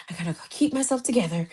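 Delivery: fast, lightly put-on meme voice; hint of a frantic self-pep-talk; TikTok meme imitation. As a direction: quote the meme in a hurried rush. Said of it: "y'all probably know the TikTok I'm talking about".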